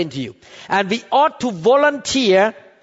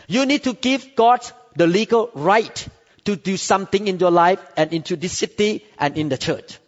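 ordinary talk, nothing marked

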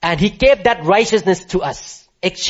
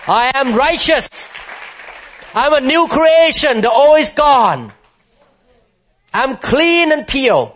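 preaching at full force; 8 semitones higher, 3 dB louder than usual. Acted. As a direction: declare it to the whole hall with rising fervour.

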